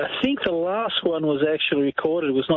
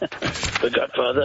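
put-on slow, drawn-out Sicilian mob-boss accent; much slower than usual. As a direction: stretch it out like a Sicilian don. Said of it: "I'll answer in my best Sicilian accent".

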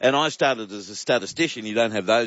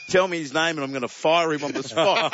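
laughing; 5 semitones higher than usual. Retold or imitated. Retold quip, laughing through it.

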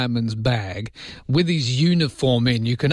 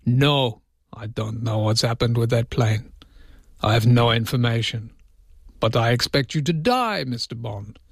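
mock Russian spokesman, measured with pauses; Russian accent, deadpan denial turning to villain threat; not-quite-Russian accent; 3 semitones lower than usual. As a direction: put on a rough Russian accent, Bond-villain style. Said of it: "my accent wasn't quite Russian enough".

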